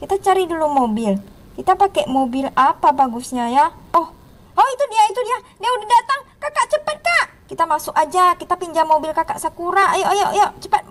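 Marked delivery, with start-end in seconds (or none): 3.9-7.2 put-on high-pitched excited kid voice; mock panic, climbing higher; alarmed little sibling. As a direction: warn the elder in a put-on child voice. Mio spots the ghost coming.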